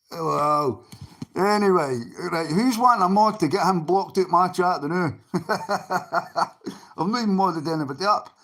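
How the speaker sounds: usual speaking voice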